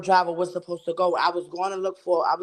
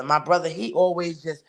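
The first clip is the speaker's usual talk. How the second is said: ordinary talk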